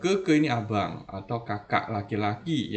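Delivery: ordinary talk, nothing marked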